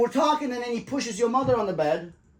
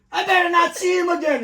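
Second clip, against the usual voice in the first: higher, louder mock tough-guy threat; imitating angry threat; 8 semitones higher, 5 dB louder than usual. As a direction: ape his blustering warning. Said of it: "he goes".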